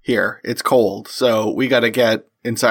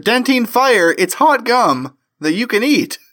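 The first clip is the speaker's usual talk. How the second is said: fast, upbeat sponsor read; 4 semitones higher than usual. Acted. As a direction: sell it like a radio spot.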